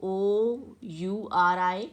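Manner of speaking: letters drawn out very slowly; slow, deliberate letter naming; slow spelling out. Shown as a demonstration. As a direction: read each letter slowly to the class. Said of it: "तो ये वाले words जिसमें".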